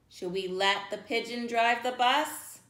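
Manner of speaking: usual speaking voice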